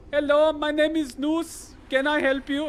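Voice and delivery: high, slow, loud mock Indian call-centre accent; mock Indian tech support accent. Streamer imagines the robot with an Indian accent, hammed up. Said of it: "Indian tech support access, uh, accent".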